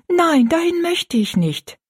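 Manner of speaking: high, drawn-out plea of refusal; girl's protest. A narrator's reading. Rosalie begging not to go, high and slow. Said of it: "sagte Rosalie rasch".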